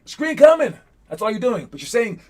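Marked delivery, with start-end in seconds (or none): 0.0-0.7 high, loud call of screen coming; court call. Shown as a demonstration. Player screaming a screen call to the coach. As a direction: shout the warning across the court. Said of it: "But you're screaming it like".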